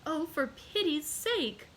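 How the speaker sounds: high, slow, begging; mother begging high and slow; pleading cry. Acted. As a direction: implore him for pity. Mother begs the Count.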